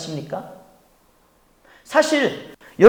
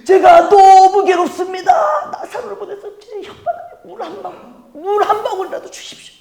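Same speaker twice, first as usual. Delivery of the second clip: a higher, pleading cry from the rich man in torment; crying out in agony, begging father Abraham; desperate rich man; 8 semitones higher, 5 dB louder than usual. Acted. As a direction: beg for a drop of water as if burning. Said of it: "이 부자는 어떻게".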